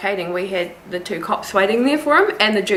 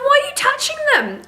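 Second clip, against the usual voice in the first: very high, incredulous question; mock shocked squeal; exasperated squeak. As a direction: shoot it up high in disbelief. Her own retold challenge.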